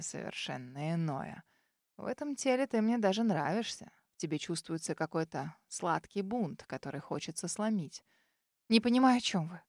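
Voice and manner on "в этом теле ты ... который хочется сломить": lower, quieter rough purr; a predatory low murmur; dragon's low, quiet menace; rough cold voice. A narrator's reading. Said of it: "Голос Ральфаира грубый и какой-то шершавый".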